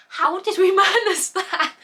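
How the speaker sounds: laughing, high and loud. Real, not acted. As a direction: laugh through the question in disbelief. Laughing disbelief at ranking.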